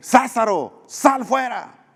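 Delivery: high, loud summons into the tomb; commanding call. Acted. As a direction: call Lazarus out with full authority. Jesus calls Lazarus from tomb. (llamado fuerte y agudo de Jesús a Lázaro)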